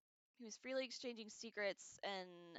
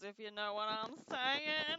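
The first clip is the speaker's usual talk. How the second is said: slow suggestive tease; slow, louder, knowing innuendo; 6 dB louder, slower than usual. Real, not acted. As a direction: wink it to the viewers.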